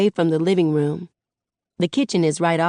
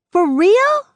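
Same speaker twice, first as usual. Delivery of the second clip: excited disbelief; 6 dB louder than usual. A narrator's reading. Excited reaction to mom's new job.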